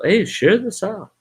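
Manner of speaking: high, hammed-up urging voice; mock reminder. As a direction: cheerfully nag them to share. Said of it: "I'm gonna be reminding people".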